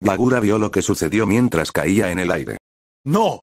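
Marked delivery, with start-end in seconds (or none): 3.1-3.4 a sharp, much higher cry of no; shout. A narrator's reading. Yagura seeing his zombie fall, high shout. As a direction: cry out in alarm, pitched up. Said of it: "gritó".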